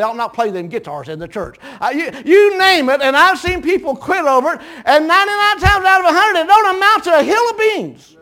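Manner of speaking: high, loud preacher's cry; soaring revival preaching; preaching at full force. Acted. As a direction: lift it into a ringing sermon climax.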